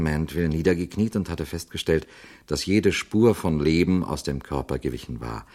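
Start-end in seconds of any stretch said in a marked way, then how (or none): none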